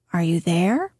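"there" lifted in a slight questioning rise; rising inflection. Shown as a demonstration. Reader models the important name's tune, rising inflection. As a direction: let THERE turn gently upward. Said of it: "said with a slightly rising inflection".

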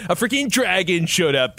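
ordinary talk, nothing marked

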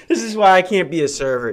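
ordinary talk

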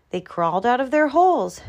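plain reading voice

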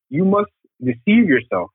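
ordinary talk, nothing marked

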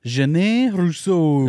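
slow, drawn-out French accent. Shown as a demonstration. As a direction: say the name in an exaggerated French accent. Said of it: "try it with a French accent again".

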